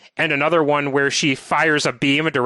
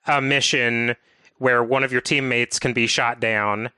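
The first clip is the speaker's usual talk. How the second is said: usual speaking voice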